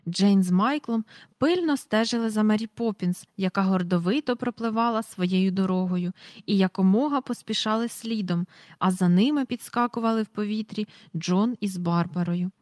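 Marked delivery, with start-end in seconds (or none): none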